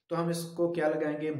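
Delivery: usual speaking voice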